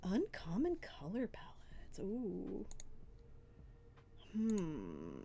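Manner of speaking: soft, whispery pondering; hushed musing. Real, not acted. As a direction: mull it over under your breath. Mulling over the question just asked.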